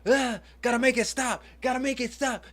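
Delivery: high, whiny mock panic; mock everyone begging to make it stop; panicking people. Mocking people who panic over cringe, high and whiny. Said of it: "Everybody's just like".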